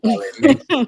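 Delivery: loud, high mocking cheer; mock the player with a big ironic well done; sarcastic high shout of praise. Mock sarcastic clap for Vaughan.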